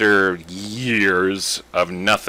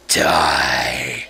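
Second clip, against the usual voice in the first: low whispered evil laugh-shout; evil Flowey; 10 semitones lower than usual. Acted. Flower condemning the player, menacing whisper. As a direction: spit it out with menace. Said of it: "my superb voice acting".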